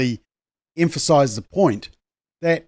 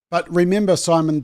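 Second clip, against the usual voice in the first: ordinary talk, nothing marked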